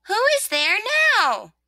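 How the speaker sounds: high, loud demand; exasperated rosebud. Acted. Rosebud disturbed by another visitor, exasperated demand. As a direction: call out high, out of patience.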